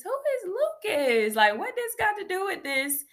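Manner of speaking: high-pitched, incredulous questioning; baffled. Retold or imitated. Reacting to an unknown name on Google, baffled. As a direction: pitch it way up, totally puzzled. Retold puzzled reaction to search result.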